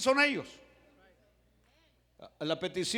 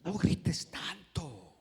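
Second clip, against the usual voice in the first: slow whispered reproof; hushed shushing; much slower than usual. Acted. Bystanders hushing the shouting Bartimeo, whispered. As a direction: whisper it, drawing out the words.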